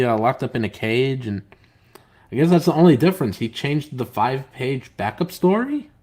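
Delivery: ordinary delivery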